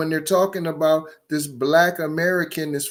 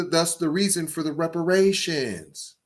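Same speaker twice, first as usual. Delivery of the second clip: usual speaking voice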